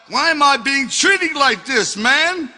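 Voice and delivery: loud, high, aggrieved mock English accent. Thrown-out musician complaining to the doorman, high mock English accent. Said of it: "shitty English accent".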